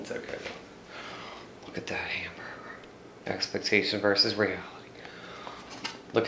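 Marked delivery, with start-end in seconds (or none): 0.0-1.6 softer, slow whispered repeat. Real, not acted. Vlogger reassuring himself, slow whisper. 1.6-2.4 soft whispered aside. Real, not acted. Vlogger eyeing the burger, whispered aside.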